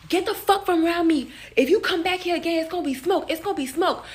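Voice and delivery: high-pitched mock yell of a threat; imitated yelling. Stylist driving her customer away, imitated yelling. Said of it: "Why the fuck are you yelling".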